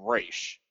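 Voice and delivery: Raish said as "raysh"; Raish pronunciation. Shown as a demonstration. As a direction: say Raish with "ay" and a final "sh". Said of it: "Batman animated series, they call him".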